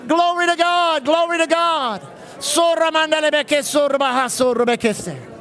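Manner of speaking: preaching at full force. Real, not acted. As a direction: whip the church into prayer. Preacher leads loud group prayer.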